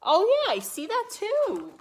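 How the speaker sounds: high, loud delighted exclamation; excited. Real, not acted. Excited reply to child.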